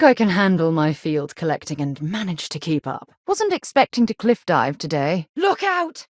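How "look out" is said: sharp high shout of alarm; warning shout. Acted. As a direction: yell it to stop them falling. Sudden danger, warning companion.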